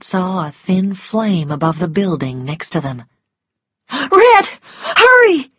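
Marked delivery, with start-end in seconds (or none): 4.2-5.5 much higher, louder urgent cry; shouting. A narrator's reading. Scarlet shouts at Rhett as the building burns, high and loud. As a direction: yell at him to hurry. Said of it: "she shouted".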